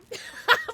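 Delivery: high cackling laugh like a witch; witch cackle. Real, not acted. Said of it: "my witch cackle".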